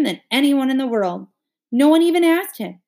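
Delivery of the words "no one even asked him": outraged. Acted. Outraged inner voice.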